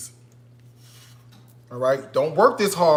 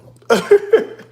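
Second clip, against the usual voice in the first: loud, high burst of laughter; 9 dB louder than usual. Real, not acted. Cook amused by own rolling pun, loud high laugh.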